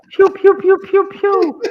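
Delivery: high, loud pew pew noises; laser sound effects. Retold or imitated. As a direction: play a toy laser gun. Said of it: "He's like".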